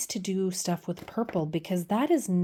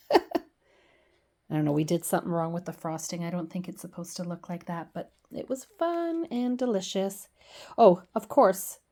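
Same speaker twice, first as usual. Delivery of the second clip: normal talking voice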